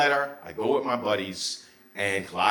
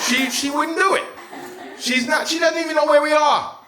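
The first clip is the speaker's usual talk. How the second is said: much higher, louder, almost shrieking; high frantic shout; 6 dB louder than usual. Retold or imitated. Telling his buddies his mother can't find them, high frantic shout. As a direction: squeal it in disbelief.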